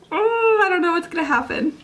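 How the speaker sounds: high, fast, nervous rush; anxious excitement. Real, not acted. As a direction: blurt it out, keyed up and worried. Anxious about the story ending.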